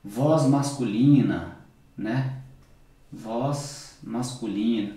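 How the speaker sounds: low, slow thick voice for grosso; deep male voice demo. Shown as a demonstration. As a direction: drop the voice low to show a deep sound. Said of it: "vamos falar, a voz masculina". (voz grossa, bem grave e lenta)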